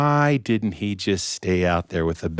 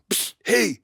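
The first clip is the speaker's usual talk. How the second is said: furtive call; 11 semitones higher than usual. Acted. Beggar secretly calls king at table.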